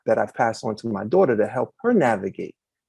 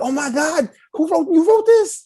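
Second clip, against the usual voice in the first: put-on much higher, gushing girl's voice; squealing with delight, pitched way up; daughter's excitement; 5 dB louder than usual. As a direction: play his thrilled daughter finding the book. She's like.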